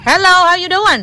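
high, loud hello; excited greeting. Real, not acted. Parent greets another parent arriving, excited greeting. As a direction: greet brightly at full volume.

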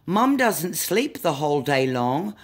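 ordinary delivery

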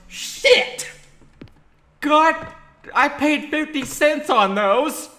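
loud, high wailing complaint; hammed-up outrage. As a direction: yell in mock grief over burnt noodles. Mock grief over burnt noodles.

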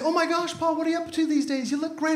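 high-pitched delighted teacher; gushing greeting. Retold or imitated. Teacher greets former pupil.

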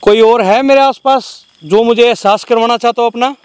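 loud, high-pitched call into the dark. Real, not acted. Ghost hunter to an unseen presence nearby, calling out. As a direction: call out for anyone who might be there.

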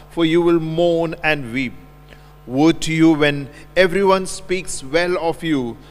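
usual speaking voice

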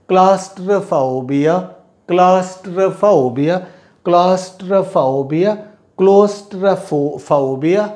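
claustrophobia repeated slowly, syllable by syllable; slow classroom repetition of claustrophobia; slow pronunciation drill. Shown as a demonstration. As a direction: model claustrophobia slowly for pupils to copy. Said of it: "teacher drilling pronunciation".

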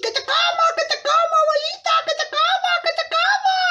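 very high, frantic wolf chant; attacking wolf. Acted. Wolf chasing grandmother to eat her, high frantic voice. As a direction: chant it in a high squeal, on the chase. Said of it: "Pasa el lobo corriendo".

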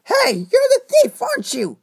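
high, loud accusing yell; loud high shout. Acted. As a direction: call out the thief, loud. Confronting the thief.

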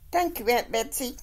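happy thanks. A narrator's reading. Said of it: "Elizabeth Marie says happily".

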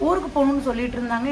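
usual speaking voice

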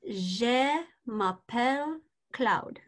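"Je m'appelle Claude" spelled out slowly, syllable by syllable; slow careful demo. Shown as a demonstration. Teacher slowing "Je m'appelle Claude" right down for the student. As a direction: say "Je m'appelle Claude" slowly so he can really listen. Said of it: "Really listen".

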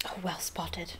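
low-voiced acid sarcasm; hushed, withering comeback. A narrator's reading. Girl answers a boy who just noticed she's one, acidly. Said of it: "she said acidly".

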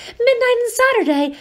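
hoarse, high, alarmed rasp. Acted. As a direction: croak it out in a panic. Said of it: "he said in a hoarse voice".

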